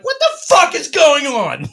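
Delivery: loud, high, drawn-out yell; incredulous shout. Retold or imitated. Woman gets out of the car to find chaos, incredulous shout. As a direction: yell it as an alarmed bystander. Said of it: "she's like".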